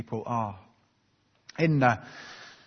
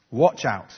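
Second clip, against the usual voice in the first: WATCH OUT hit hard and loud; emphatic warning; 8 dB louder than usual. Retold or imitated. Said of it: "It's very emphatic".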